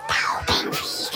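slow, soft whisper into an ear. Acted. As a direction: whisper the word slowly and secretly. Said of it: "ich flüster dir das Wort ins Ohr". (langsam und leise ins Ohr geflüstert)

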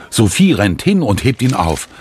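plain reading voice

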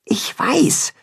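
high, unvoiced stage whisper; Timme, whispering. A narrator's reading. Timme answers the mole, whispering. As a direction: whisper it back. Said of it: "flüstert Timme".

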